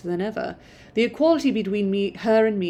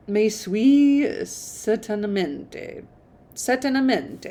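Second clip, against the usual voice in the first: attempted French; 2 semitones higher, slower than usual. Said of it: "I just want to know how to s".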